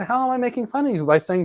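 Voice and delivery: high-pitched, mildly exasperated question; incredulous retort. Retold or imitated. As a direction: throw the accusation back in disbelief. Defends himself in retold quarrel.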